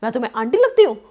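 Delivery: retold angry outburst. Shopkeeper woman scolding husband for calling her aunty, loud indignant imitation. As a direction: throw the question back at him, offended. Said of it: "उस पे ना वो गुस्सा हो गई बहुत और बोलती है".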